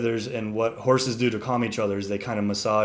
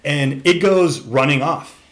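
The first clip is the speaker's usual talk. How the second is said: ordinary talk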